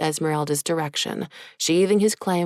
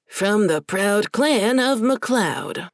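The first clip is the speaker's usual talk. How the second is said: proud Scottish burr; 5 semitones higher, 3 dB louder, slower than usual. Acted. Demon proudly announces Scottish clan.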